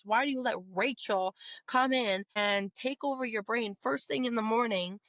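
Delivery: ordinary talk, nothing marked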